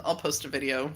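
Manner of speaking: ordinary talk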